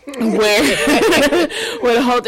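laughing through the words; giggling mid-sentence. Real, not acted. Laughing while trying to talk.

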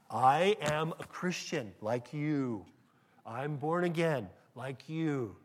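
spelled out word by word; mock-patient, spacing each word to get through; slow, deliberate. Believer answers a badgering street preacher, slow and emphatic.

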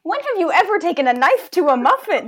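loud, laughing incredulous question; laughing disbelief. Real, not acted. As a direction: challenge them through a laugh. Incredulous challenge in debate.

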